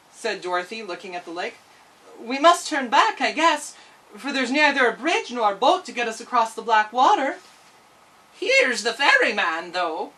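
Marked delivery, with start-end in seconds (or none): 2.2-7.4 put-on higher, louder girl voice; disappointed girl turning back; Dorothy. Dorothy stopped at the lake. 8.4-10.1 slow, louder odd little man; a tiny man calling up. Acted. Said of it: "cried a tiny voice".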